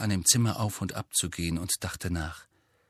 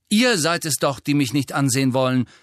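loud, higher angry retort; 6 semitones higher, 7 dB louder than usual. A narrator's reading. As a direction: throw the accusation back angrily. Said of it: "sagte Harry zornig". (zornig, laut zurückgeschleudert)

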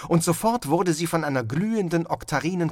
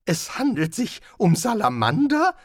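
incredulous; 9 semitones higher, 5 dB louder than usual. Acted. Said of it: "fragte Rincewind ungläubig".

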